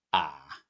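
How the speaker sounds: "ah" dipped very low; "ah" on a low dipping tone; third tone. Shown as a demonstration. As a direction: sink "ah" to the bottom of the voice. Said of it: "let's sing ah with the four tones".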